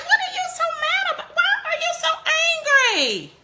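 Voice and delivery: high, fast, laughing parent asking why; mock exasperated parent. Mother imitating a parent puzzled by her angry teen. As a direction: squeal it out like a baffled mom.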